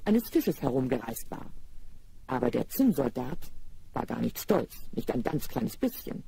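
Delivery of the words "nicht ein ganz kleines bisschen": ordinary delivery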